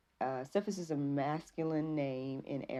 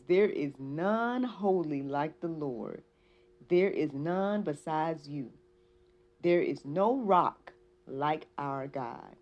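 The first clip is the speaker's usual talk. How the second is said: slow, louder, measured Bible reading; scripture reading; 5 dB louder, much slower than usual. Acted. Reading First Samuel to listeners, slow emphatic scripture delivery. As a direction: proclaim each phrase deliberately, with space between.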